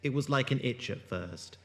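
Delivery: low, measured narration, eerie and unhurried; story narration. A narrator's reading. Narrator setting an ominous scene, in low measured story voice. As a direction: draw listeners into a creeping mystery. Narrator opens the audio drama.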